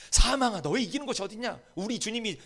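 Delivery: high, loud defiant confession; preacher's fervent shouted creed; bold confession. Shown as a demonstration. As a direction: declare it boldly to death. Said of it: "스스로 얘기하세요. 고백하세요".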